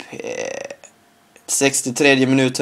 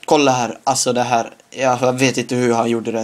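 ordinary talk

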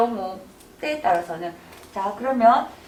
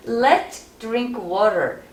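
exaggerated slow read; much slower than usual. Shown as a demonstration. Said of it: "과장되게 극단적으로".

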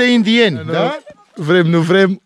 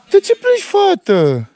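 sing-song concern; 10 semitones higher than usual. Real, not acted. Dad coaxes his crying daughter, high sing-song. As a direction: coax her with a high lilting voice.

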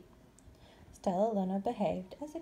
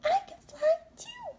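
put-on squeaky baby bat. Bat's joyful thought.

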